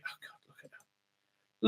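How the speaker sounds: slow, breathless whisper of disbelief; whispered groan at the screen; whispered aside. Real, not acted. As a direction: breathe it out in disgust. Aside, reacting to the clip.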